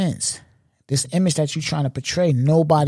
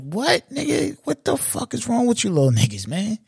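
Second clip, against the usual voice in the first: high-pitched, disbelieving reaction; incredulous outrage; 9 semitones higher than usual. Real, not acted. Reacting to a son disrespecting T I, incredulous.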